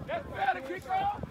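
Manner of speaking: ordinary talk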